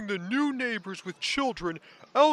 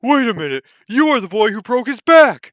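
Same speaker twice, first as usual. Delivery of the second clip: overacted anime dub; 12 dB louder than usual. Shocked shouted accusation.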